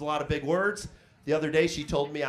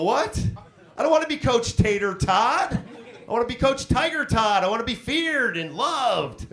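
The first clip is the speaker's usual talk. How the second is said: higher, louder mock outrage; indignant; 6 semitones higher, 4 dB louder than usual. Coach protesting his nickname, higher louder mock outrage. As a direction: play up wounded pride.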